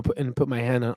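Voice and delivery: usual speaking voice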